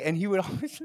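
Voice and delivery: ordinary talk, nothing marked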